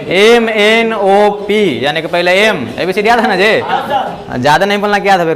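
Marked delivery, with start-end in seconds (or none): 0.1-1.8 very slow, letter by letter; drawing out each letter; slow recital. Shown as a demonstration. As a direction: spell out एम एन ओ पी slowly for the class. Reciting alphabet order to class.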